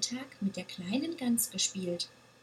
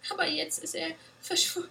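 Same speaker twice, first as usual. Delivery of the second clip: sobbing; 7 semitones higher, faster than usual. A narrator's reading. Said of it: "schluchzte sie".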